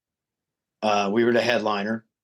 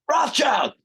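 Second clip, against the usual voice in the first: excited recognition. Retold or imitated. Reacting to song choice.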